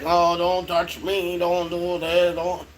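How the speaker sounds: slow, drawn-out grumbling imitation; grumpy kid. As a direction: imitate a grumpy youngest child complaining slowly. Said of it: "he's a grumpy guy".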